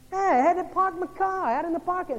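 loud, high mock New York accent. Newcomer greets a group of Oklahomans and Texans, mock New York accent. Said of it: "His accent gives him away".

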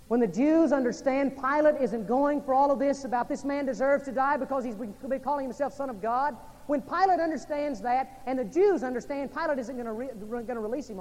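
loud, high preacher's climb; urgent pulpit storytelling; preaching at full force. Acted. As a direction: press the story forward with fire. Preacher builds the narrative.

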